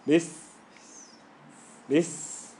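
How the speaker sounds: "This", said as "bis" with a long hiss; held s. Shown as a demonstration. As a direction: switch from b straight into a sustained s. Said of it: "Watch this".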